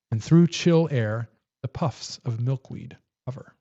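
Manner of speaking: slow, measured poetry recitation; poem reading. A narrator's reading. Host reads a winter poem aloud, slow poem reading. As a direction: read the verse slowly, pausing at each phrase.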